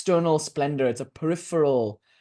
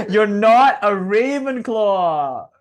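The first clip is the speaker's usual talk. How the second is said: higher, louder, slow and giggly; barely holding in laughter; laughing; 4 semitones higher, 6 dB louder, much slower than usual. Real, not acted. Retelling friend's joke, cracking up.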